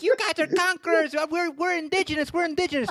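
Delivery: put-on high Spanish-accented protester voice; mock Spanish-accented yell. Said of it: "Spanish accent".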